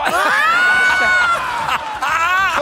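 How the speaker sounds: hammed-up villain shout; villain discovery. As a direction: crow like a stage villain finding the hero. Villain triumphant on stage.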